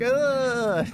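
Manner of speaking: high, drawn-out "ugh"; exasperated groan. Retold or imitated. Streamer mimics the reaction at the end, high groan. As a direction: let out a high mock groan.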